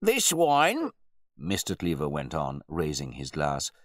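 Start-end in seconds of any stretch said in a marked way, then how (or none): 0.0-0.9 loud, high-pitched proclamation; self-important host holding forth; pompous wine bore. A narrator's reading. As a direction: announce it grandly to the guests. Host shows off his wine.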